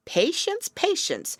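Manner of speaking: high raven voice, rise then fall; slow her down, as the raven; raven calming. Acted. Raven calms eager Gerda in a high voice.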